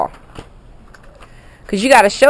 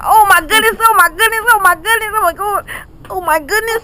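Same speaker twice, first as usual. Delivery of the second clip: amused astonishment; 12 semitones higher than usual. Real, not acted. Grandma reacting to the child's antics.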